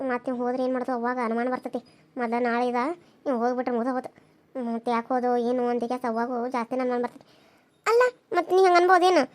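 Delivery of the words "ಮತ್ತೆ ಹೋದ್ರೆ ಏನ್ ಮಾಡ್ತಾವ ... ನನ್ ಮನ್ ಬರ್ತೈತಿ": low, hushed and much softer; hushed worry. Acted. Wife hushes her lover so nobody grows suspicious, hushed. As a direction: keep it down so nobody overhears. Worried others will grow suspicious.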